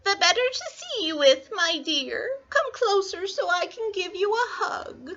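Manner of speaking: put-on high, scratchy old-lady falsetto; fake grandmother. Wolf luring Little Red closer, high fake granny voice.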